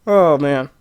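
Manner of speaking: normal talking voice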